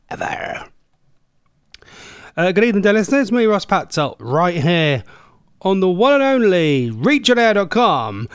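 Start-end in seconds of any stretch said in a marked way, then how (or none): 2.4-8.3 big, loud, higher-pitched station intro; radio announcer. Pretend. Host greeting dear listeners on air, radio announcer. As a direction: announce the show with punchy emphasis.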